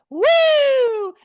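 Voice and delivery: loud, very high "woo"; long hammed-up whoop; imitated whoop. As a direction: whoop it up like a show-off. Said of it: "He says it like this".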